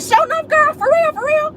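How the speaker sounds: over-the-top fake girlfriend cheer; fake hype. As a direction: gush falsely like a supportive friend. Fake friendly reply to friend.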